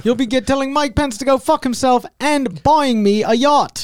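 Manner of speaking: high and giddy, like a grifter's gloat; gleeful mock pitch. Mocking the children's book author.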